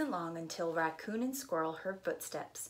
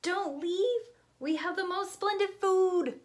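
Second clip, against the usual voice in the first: high, louder pleading; pleading raccoon; 11 semitones higher, 5 dB louder than usual. Acted. Raccoon begging the guests to stay, high pleading. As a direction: beg them to stay, pitched up. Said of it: "said Raccoon".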